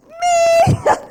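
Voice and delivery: high, loud squeal from the guests; excited shout. Retold or imitated. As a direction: shout it like the whole room answering. Room shouts back excitedly.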